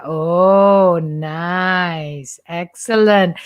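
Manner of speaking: usual speaking voice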